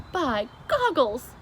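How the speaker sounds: loud, slow giant exclamation; BFG delighted cry. A narrator's reading. The giant tastes breakfast, crying out delighted. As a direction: relish it. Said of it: "he cried".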